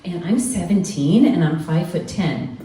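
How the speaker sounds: hammed-up bright teen; younger and spunkier. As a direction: sell yourself as a bubbly teenager. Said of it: "seem younger and spunkier".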